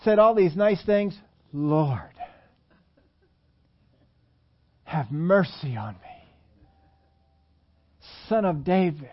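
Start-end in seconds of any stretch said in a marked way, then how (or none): 1.5-9.1 slow, understated plea; mild imploring. Acted. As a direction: beg gently, leaving space between phrases. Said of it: "you implored, you, you begged".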